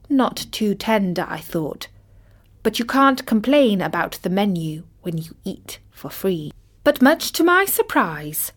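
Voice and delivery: ordinary delivery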